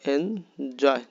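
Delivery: এনজয় split into এন and জয়; two syllables. Shown as a demonstration. Teacher shows এনজয় has two parts, saying them separately. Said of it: "দুই ভাগে".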